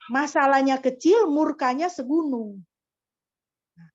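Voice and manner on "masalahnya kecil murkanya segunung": preaching emphasis. Real, not acted. Preacher's climactic point.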